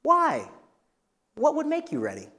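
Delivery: high, soft, curious "why"; natural, easy follow-up question. Shown as a demonstration. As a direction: gently probe in a lifted voice. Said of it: "very natural and easy next question".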